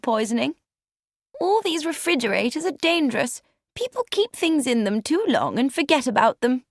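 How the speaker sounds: light high voice, fretting over a danger; wife's voice. A narrator's reading. Wife's chatty lines in story.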